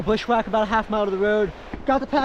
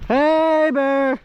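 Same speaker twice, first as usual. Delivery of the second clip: calling out to bears; 5 semitones higher, 4 dB louder than usual. Real, not acted. Hiking in bear country.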